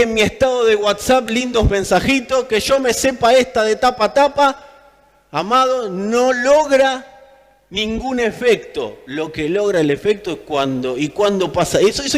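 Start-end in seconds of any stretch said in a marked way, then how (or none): none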